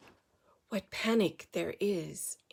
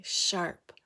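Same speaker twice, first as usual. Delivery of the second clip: ordinary delivery, nothing marked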